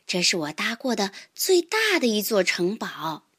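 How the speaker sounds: usual speaking voice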